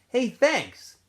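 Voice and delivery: high, loud thanks; calling thanks. A narrator's reading. Stanley calling thanks to the other boys, high and loud. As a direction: call out grateful.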